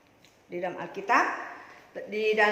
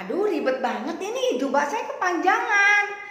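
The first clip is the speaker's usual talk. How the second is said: louder, higher exasperated complaint; fussed grumbling; 7 semitones higher, 7 dB louder than usual. Acted. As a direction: whine about a bothersome robe, stretching the end. Bartimeus grumbles about his robe. (mengeluh kerepotan, nada tinggi)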